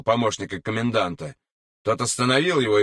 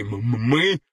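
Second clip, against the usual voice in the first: stuttered M before the word; embarrassed, stuck on the first sound; mouth full, stammering; slower than usual. A narrator's reading. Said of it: "с набитым ртом покраснев, проговорил Андрей".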